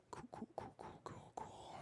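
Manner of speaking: soft repeated whisper. Real, not acted. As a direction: breathe the words out quietly. Whispered filler after thanks.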